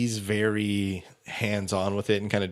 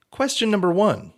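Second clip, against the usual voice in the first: game show host; 10 semitones higher, 3 dB louder than usual. Pretend. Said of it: "forgot it's a game show".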